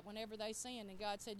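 usual speaking voice

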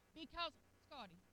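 ordinary delivery

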